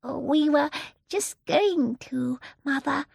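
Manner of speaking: high, slow, timid little voice; frightened, hesitant. A narrator's reading. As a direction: falter timidly before a fierce mother. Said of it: "in a frightened whisper".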